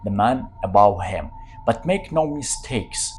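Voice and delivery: usual speaking voice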